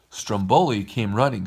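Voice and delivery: usual speaking voice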